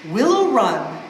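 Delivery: usual speaking voice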